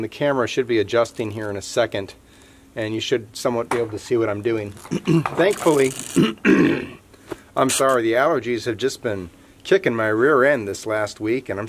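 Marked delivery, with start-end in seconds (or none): 4.7-5.9 clears throat, then a slow word; throat clearing from allergies. Real, not acted. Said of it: "grunting and throat clearing". 6.0-6.9 harsh, high throat clearing. Real, not acted. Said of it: "grunting and throat clearing".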